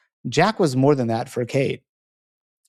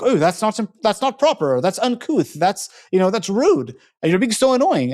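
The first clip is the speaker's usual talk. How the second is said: put-on higher-pitched scandalized young socialite; knee-jerk prim disapproval; 6 semitones higher than usual. Upper-class girl objecting to free-spirited Jack, prim indignation. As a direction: voice her offended objections, pitched up. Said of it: "like, knee-jerk reactions to what he says".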